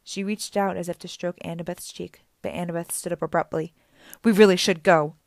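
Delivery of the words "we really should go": louder, urgent insistence. Acted. Annabeth urgently telling Auntie Em they must leave, loud. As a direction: push them out the door.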